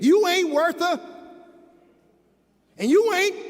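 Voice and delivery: lightly acted harsh parent; acted parental put-down.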